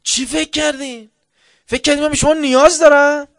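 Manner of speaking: loud, high, defiant shouting; booming battlefield challenge; commander's shout. Retold or imitated. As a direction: shout it at the troops like a fed-up commander. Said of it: "یه دفعه بلند شده اینجوری صحبت کرده".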